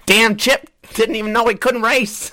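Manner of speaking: high, loud comic exclamation; mock amazement. Retelling a friend's reaction to Chip racing, mock amazed.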